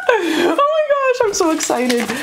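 very high, squealing oh my gosh; shrieking giddy joy; squealing excitement. Real, not acted. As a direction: squeal with pure delight. Ecstatic squeal over the card.

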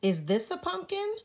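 small voice, full of hope; Spook asking. A narrator's reading. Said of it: "Spook asks".

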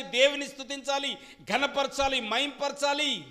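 high, loud fervent sermon voice; full-force preaching. Acted. Preacher urging children to honour mothers, full-force preaching. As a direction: drive every line home to the church.

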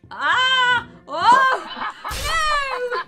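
high loud wail of defeat; anguished screams. Real, not acted. Caught at last by the monster, screaming.

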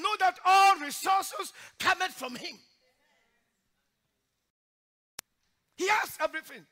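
loud, high preaching cry; preaching at full force. Acted. Preacher exhorting the church in a loud preaching cry. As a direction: drive each line home like a revival preacher.

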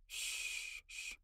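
barely audible soft shush. Acted. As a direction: hush them very close. Soothing close, hugging.

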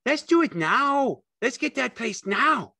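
very high, loud, eager pleading; Lenny begging. A narrator's reading. Lenny begging George for the place now, high and loud. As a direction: beg like an impatient big child. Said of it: "Lenny begged".

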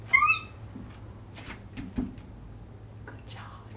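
tiny whispered voice; barely audible hush; quiet. Shown as a demonstration. Teacher praising children's quiet ribbit, whisper. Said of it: "That was so quiet".

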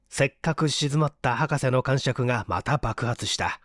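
ordinary delivery

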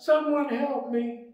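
high, loud cry for help in the dark; terrified man calling out in the dark; panicked shout. A narrator's reading. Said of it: "he shouted out".

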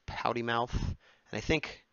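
normal talking voice

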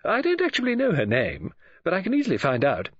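high voice, hurrying at the end; excited woman friend. Acted. Woman friend promises to find the name, eager. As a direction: admit it, then offer quickly to help.

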